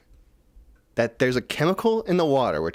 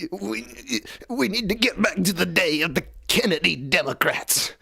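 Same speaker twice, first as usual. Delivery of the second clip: high, put-on politician voice; a hammed-up imitation of a stump speech; mock RFK voice; 8 semitones higher than usual. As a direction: lampoon him with a lofty pitch. Mock RFK quote, far and high.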